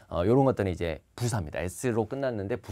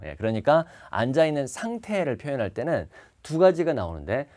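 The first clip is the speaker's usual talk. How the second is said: ordinary talk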